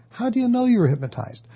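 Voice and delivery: a friend asking with wide-eyed curiosity; curious friend. Retold or imitated. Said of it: "the person might ask your client".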